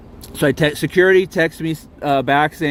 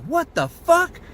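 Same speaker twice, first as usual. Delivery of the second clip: incredulous; 13 semitones higher, much slower than usual. Retold or imitated. Retold outburst, voice jumps high.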